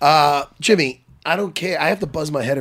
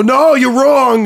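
loud; 9 semitones higher, 8 dB louder, much slower than usual. Retold or imitated. Imagined retort to friend.

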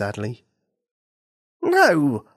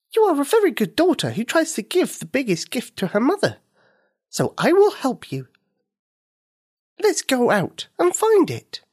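high, kind little firefly, encouraging; a helpful creature cheering the girl on; 12 semitones higher than usual. Acted. Firefly offers help.

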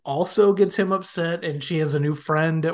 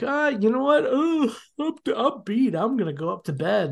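high-pitched exasperated groan, easing down; hammed-up fed-up excuse, starting high; mock annoyed; 8 semitones higher than usual. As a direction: imitate a husband at his breaking point. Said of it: "so annoyed".

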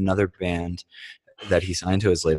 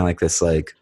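ordinary delivery

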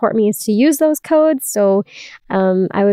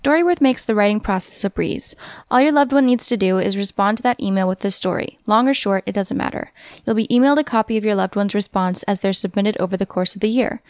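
ad read; 3 dB quieter than usual. Acted. Host reads a sponsor ad.

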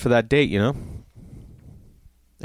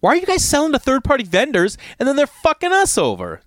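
annoyed rant; 14 semitones higher than usual. Retold or imitated. Ranting at the box office clerk, fed up and high. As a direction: take a stand, fed up. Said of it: "then I'm getting annoyed".

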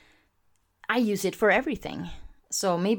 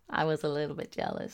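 a much softer, quicker mutter of the confession; quiet aside; 4 semitones lower, 7 dB quieter, faster than usual. Real, not acted. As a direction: tuck it away as a hushed sheepish echo. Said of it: "and I was a little bit jealous".

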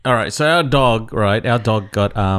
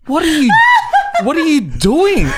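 much higher and louder, laughing through it; reacting to the noise, shouting while laughing; startled shout; 5 dB louder than usual. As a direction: shout as the door bursts open. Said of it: "And I was like".

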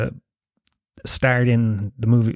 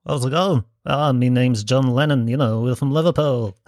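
bad Liverpool accent. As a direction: put on a terrible Liverpool accent. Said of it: "got me to do the dubbing".

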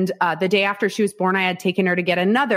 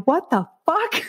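high, crying-out what the fuck; stunned, half laughing, half crying; shocked disbelief; 8 semitones higher than usual. Retold or imitated. Disbelief on learning the test result. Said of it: "And I'm like".

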